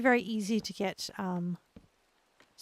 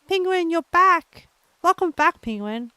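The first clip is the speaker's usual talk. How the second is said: excited welcome; 8 semitones higher, 7 dB louder than usual. Real, not acted. Greeting returning viewer Penguin.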